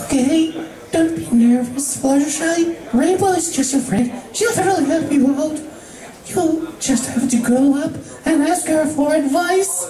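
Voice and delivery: high, timid pony voice; Fluttershy pepping herself up, nervously. Acted. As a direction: psych yourself up, shy and unsure. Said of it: "Fluttershy nervously walked".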